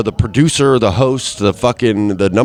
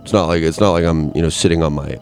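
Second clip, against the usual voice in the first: ordinary delivery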